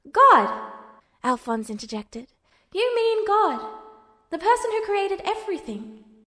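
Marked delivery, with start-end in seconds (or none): 0.1-0.7 sudden high, loud burst of realisation; surprised exclamation. A narrator's reading. 2.7-6.2 put-on higher, lighter young voice explaining; bright little brother voice, raised; Alphonse's voice. A narrator's reading.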